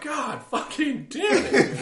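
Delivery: high, slow laughing curse; exasperated curse. Retold or imitated. Boy shamed before the family curses slowly, half laughing. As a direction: drag out the curse in mock despair.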